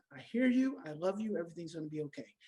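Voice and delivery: put-on calm parent soothing a defiant child; calm reassurance. Shown as a demonstration. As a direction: settle the child with a gentle fading voice. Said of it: "Take a deep breath".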